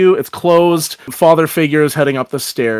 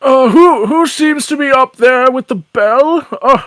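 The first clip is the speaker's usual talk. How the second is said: high-pitched, bleary character voice; puzzled sleeper roused from a dream; woken Father; 10 semitones higher than usual. Acted. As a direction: call up in a high, muddled voice.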